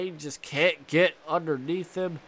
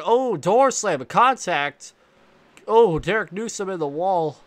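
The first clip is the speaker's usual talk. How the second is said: loud, higher burst of live commentary; excited crash call; 4 semitones higher, 7 dB louder than usual. Real, not acted. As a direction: call the wall hit with sudden loud excitement.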